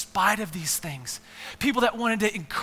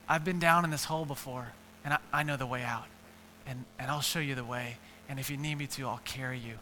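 lower, softer, steady reassuring voice; calm reassurance; 7 semitones lower, 7 dB quieter, faster than usual. Acted. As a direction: quietly promise to carry him. Friend reassures man in hole.

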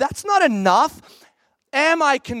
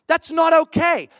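higher, louder exasperated protest; mock shout; 6 semitones higher, 3 dB louder than usual. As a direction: throw up your hands at a reckless friend. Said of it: "would you not look at Peter and go".

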